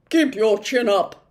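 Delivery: loud encouraging call from afar; humbug shouting. Acted. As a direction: call after them cheerfully. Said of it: "shouted the humbug".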